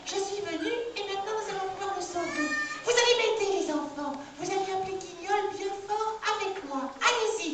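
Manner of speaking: put-on a kind fairy greeting the children; bright squeaky sing-song welcome. Fairy voice for the puppet.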